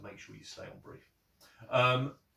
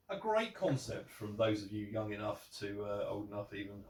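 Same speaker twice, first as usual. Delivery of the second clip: ordinary talk, nothing marked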